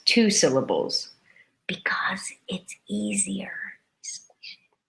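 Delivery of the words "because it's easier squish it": playful trailing whisper. Acted. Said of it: "playful whispered ending".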